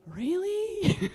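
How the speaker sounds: high, rising, incredulous "really"; mock disbelief. Speaker voices the audience doubting the chant lesson, mock disbelief. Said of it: "You're like".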